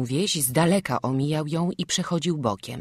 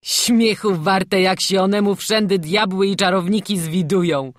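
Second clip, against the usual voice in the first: mocking call-out; 5 semitones higher, 8 dB louder than usual. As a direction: scoff at the superstition out loud. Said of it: "zawołał Kostek".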